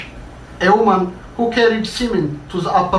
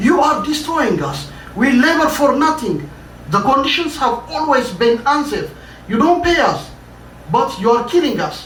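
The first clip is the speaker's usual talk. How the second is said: much higher, fast, indignant outcry; crowd yelling; 7 semitones higher, faster than usual. Acted. Workers confronting their boss, pitched-up angry outcry. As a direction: throw the accusations right in his face. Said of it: "They all yelled at the boss".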